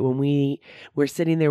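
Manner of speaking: ordinary talk, nothing marked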